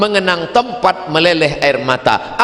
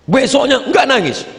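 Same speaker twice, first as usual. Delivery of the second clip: usual speaking voice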